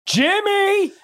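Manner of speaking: high, loud stretched cry of the name; surprised. Real, not acted. Hearing the story's punchline, a high loud shocked cry. Friend cries out in mock shock.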